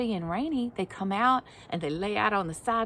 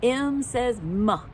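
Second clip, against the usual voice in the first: normal talking voice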